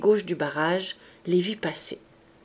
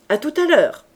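higher, louder, taunting send-off; mocking farewell; 7 semitones higher, 7 dB louder than usual. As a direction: throw the goodbye at their backs like a jab. Javert taunting the departing insurgents.

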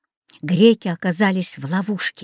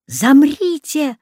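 higher, slow urgent order; sharp command; 6 semitones higher, 4 dB louder, slower than usual. A narrator's reading. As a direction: order them to freeze. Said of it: "приказал Рекс".

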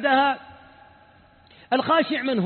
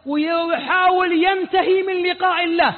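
lament; 7 semitones higher, 3 dB louder than usual. Real, not acted. Lamenting hurried prayer.

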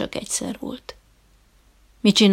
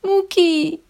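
high, whining wail of a name; plaintive, near tears; 10 semitones higher, 4 dB louder than usual. A narrator's reading. Embarrassed trainer begging his dog to act. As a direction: plead with the dog, almost crying. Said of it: "panaszos, síró hangon".

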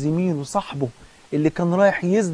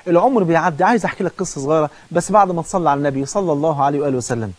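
ordinary delivery

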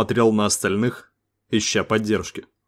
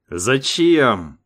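growled; 2 semitones higher, 4 dB louder than usual. A narrator's reading. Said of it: "зарычал Сейга".

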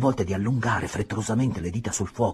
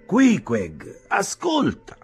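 louder call for attention; lightly voiced landlord; 11 semitones higher, 5 dB louder, much slower than usual. Landlord calling Queequeg to listen, loud. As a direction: get the harpooner's attention.

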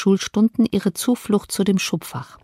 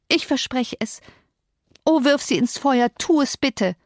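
pleading promise; 5 semitones higher than usual. A narrator's reading. Girl begs for letters burnt.

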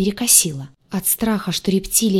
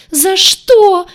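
high, loud wailing shriek; terrified squeal; 12 semitones higher, 10 dB louder than usual. A narrator's reading. Crocodile pinned by the heroine, shrieking in terror. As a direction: squeal it in desperate protest. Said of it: "визжал крокодил".

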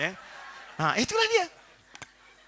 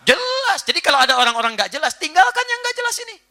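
forceful preaching; 11 semitones higher, 6 dB louder than usual. Real, not acted.

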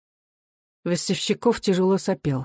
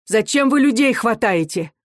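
higher-pitched protest; mother's outcry, moderately forceful; crying out; 5 semitones higher, 3 dB louder than usual. A narrator's reading. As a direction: demand it in a raised voice. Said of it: "крикнула мать".

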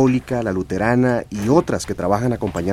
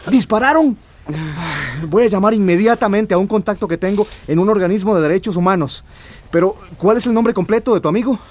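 high, urgent and quick; spring into action; alarmed urgency; 8 semitones higher than usual. Acted. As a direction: reporter reacting to news of a shooting. Journalist alarmed, acts urgently.

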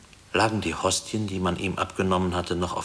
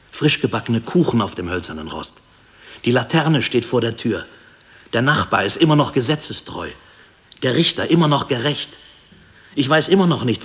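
raised, loud, insistent listing; impassioned, louder and higher declaration; fervent affirmation; 4 semitones higher, 6 dB louder than usual. Acted. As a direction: build it up, insisting each thing still stands. Fervently affirming the world still stands.

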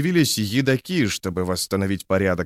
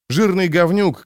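spiteful jeer; 8 semitones higher, 3 dB louder than usual. A narrator's reading. Echoed insult spat out.

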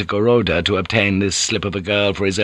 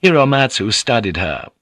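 usual speaking voice

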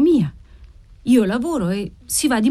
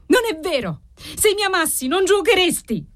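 exasperated cry; 11 semitones higher than usual. A narrator's reading. Wife rejects husband's declaration of love, exasperated cry. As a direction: cry it out at a much raised pitch. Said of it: "gridò lei esasperata".

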